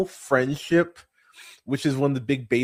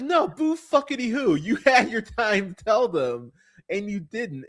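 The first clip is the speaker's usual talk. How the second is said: sassy dismissal; 8 semitones higher than usual. Retold or imitated. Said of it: "I was just like".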